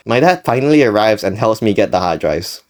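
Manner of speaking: ordinary delivery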